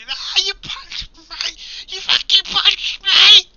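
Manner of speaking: high, slow outraged scream; pained screaming. Acted. Punched man on his knees screams at Dirk, high and slow. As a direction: shriek it out, nose just broken. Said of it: "He starts screaming".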